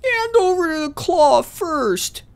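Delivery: slow, very high loud put-on voice; character voice. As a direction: demand it in a hammy character voice. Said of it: "Still working on the voice".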